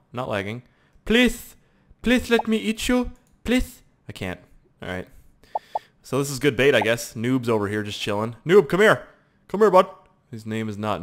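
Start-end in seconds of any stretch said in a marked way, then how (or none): 1.1-3.8 high, squeaky little plea; mock begging. Gamer begging player to let him eat, high pleading. 8.5-9.9 high, loud coaxing call. Real, not acted. Gamer luring noob to come closer, calling out. Calls to another player.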